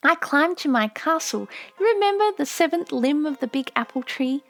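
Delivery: put-on a young daughter longing for home; little girl remembering. A narrator's reading. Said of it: "daughter's long remembered speech".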